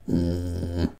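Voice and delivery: low, soft wordless grumble; growl. A narrator's reading. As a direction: growl briefly instead of answering. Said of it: "The mouse only growled in reply".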